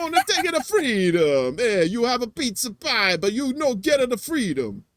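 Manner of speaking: high, hammed-up Italian caricature; mock Italian accent. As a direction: ham up an excitable Italian accent. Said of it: "we pissed off the Italians".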